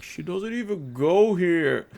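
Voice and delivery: high sneering put-down from the back row; mocking high voice. Damien sneers at the girl on stage, high mocking voice. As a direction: float it up, snide and dismissive.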